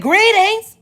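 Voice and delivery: high, loud reply shouted back; yelled greeting. Retold or imitated. Answering a caller who keeps saying hello, yelled. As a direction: yell it over the caller. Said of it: "You are the one that's yelling".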